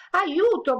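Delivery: high, loud cry for help; girl panicking at the fireworks, crying out aiuto; frightened cry. Acted. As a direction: shriek it as a scared little girl. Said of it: "gridò nascondendosi".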